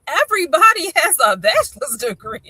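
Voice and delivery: high, loud, disbelieving repeat; incredulous echo. Retold or imitated. Mother challenging her son's claim, incredulous echo. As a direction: throw the claim back as absurd.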